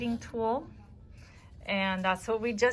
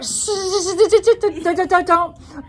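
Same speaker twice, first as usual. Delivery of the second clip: high, mock-urgent string of shushes; playful high-pitched shushing through laughter; imitated shushing; 8 semitones higher, 8 dB louder, much slower than usual. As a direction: imitate a frantic friend hushing you. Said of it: "She's like".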